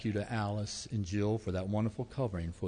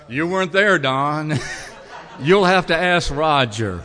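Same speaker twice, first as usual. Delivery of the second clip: much louder, laughing call-out; laughing tease; 6 semitones higher, 16 dB louder, slower than usual. Real, not acted. Pastor teasing Don that he must ask Roger, laughing. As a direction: playfully rib him across the room.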